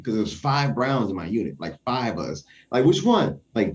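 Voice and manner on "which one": loud, higher repeated call; shouted question. Retold or imitated. Soldier repeats his shout from formation, loud and high. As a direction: shout it again down the line. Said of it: "I shouted out".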